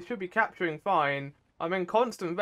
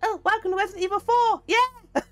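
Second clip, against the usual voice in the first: very high, fast, squeaky parody of the merchant; a high, silly take on the game's greeting; sly mysterious shopkeeper; 4 dB louder than usual. Shown as a demonstration. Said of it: "so instead I'm just like".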